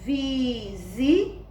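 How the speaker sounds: VISI drawn out as VI, ZI; syllable by syllable. Shown as a demonstration. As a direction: sound out VISI for learners. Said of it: "Observaram como foi que a tia leu?".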